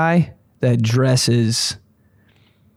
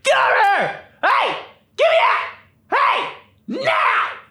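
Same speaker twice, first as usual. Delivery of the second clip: very high, screechy mock scolding; hammed-up furious mom shriek; mad mom yelling. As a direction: scold like a mother hauling a kid by the arm. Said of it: "like a mad mom".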